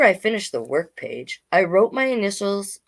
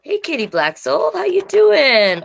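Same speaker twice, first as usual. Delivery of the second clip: thrilled to see a friend; excited greeting; 9 semitones higher, 6 dB louder than usual. Real, not acted. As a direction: call out a happy hello. Greeting a viewer in chat.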